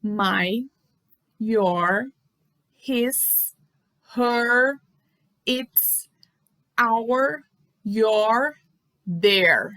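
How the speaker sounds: each word My, your, his, her, its, our, your, their said alone with a pause; slow model pronunciation. Shown as a demonstration. Teacher models each possessive for the class to hear. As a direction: read the list slowly, one word at a time. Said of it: "eu vou falar a pronúncia mais uma vez".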